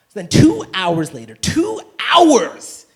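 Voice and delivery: shouted, pitch leaping up on hours; outraged shout. Acted. Comic yelling at friends who reply late. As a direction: yell the wait time in disbelief. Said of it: "comic outrage at slow reply".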